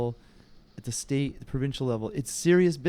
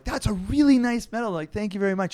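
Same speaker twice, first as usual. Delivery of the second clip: sweet-talking salesman; 6 semitones higher, 5 dB louder than usual. Retold or imitated. Mock flattering medal winners.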